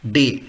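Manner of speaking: German letter D said "day"; pronuncia tedesca. Shown as a demonstration. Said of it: "D is pronunciation as".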